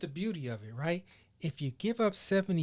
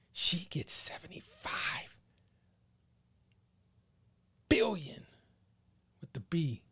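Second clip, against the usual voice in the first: awed hushed whisper; 4 dB quieter than usual. Acted. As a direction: breathe the number in awe.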